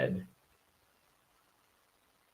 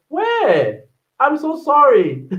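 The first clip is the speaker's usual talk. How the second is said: high, startled sorry; surprised apology; 12 semitones higher, 6 dB louder, slower than usual. Real, not acted. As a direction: caught out, apologise in a rush. Caught skipping a comment.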